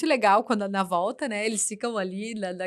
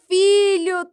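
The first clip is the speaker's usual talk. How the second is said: put-on higher, louder sing-song greeting to a toddler; sweet mom voice; 9 semitones higher, 7 dB louder, slower than usual. Mother retelling her call, greeting her son sweetly. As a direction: brighten up for your little boy.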